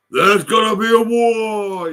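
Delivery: high, loud, slow mock shout; mocking quote. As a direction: ham up his threat as a squawk. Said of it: "I still remember you on YouTube".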